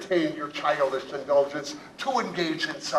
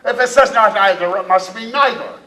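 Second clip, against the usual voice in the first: hammy pirate argument; 6 semitones higher, 7 dB louder than usual. Acted. Captain argues the code loudly.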